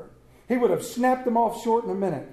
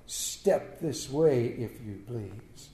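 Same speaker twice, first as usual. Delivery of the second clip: slow, much lower, softer ominous summons; mock menace; 8 semitones lower, 5 dB quieter, much slower than usual. Scrooge ordering Bob in, slow and low. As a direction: drag it out to scare him.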